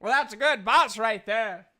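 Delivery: loud, swooping character voice; hearty, hammed-up praise; approving game character. Game master's character praises the party's boss, loudly approving.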